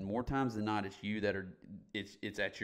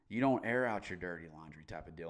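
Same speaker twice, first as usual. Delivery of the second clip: ordinary delivery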